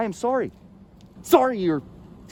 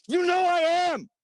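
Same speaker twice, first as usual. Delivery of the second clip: sudden angry outburst; 10 semitones higher than usual. Acted. Imagined fight flares up.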